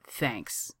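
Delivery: a soft, reluctant thanks; grudging. A narrator's reading. Johnny thanking Kaner for the coffee, grudgingly. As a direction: mutter it reluctantly. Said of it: "says Johnny grudgingly".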